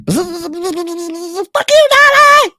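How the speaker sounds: high shrieking yell from the bees. Pretend. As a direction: scream it like an angry swarm. Bees yelling insult.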